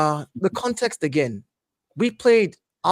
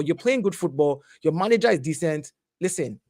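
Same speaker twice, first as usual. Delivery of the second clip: usual speaking voice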